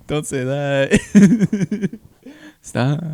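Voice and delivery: high, drawn-out words through laughter; real laughter stretching the words; helpless laughing. Real, not acted. Helpless laughing at own remark.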